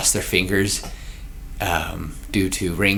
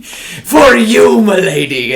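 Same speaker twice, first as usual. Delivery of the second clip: gallant pirate; 11 semitones higher, 8 dB louder, much slower than usual. Acted. Gallant pirate presents ring.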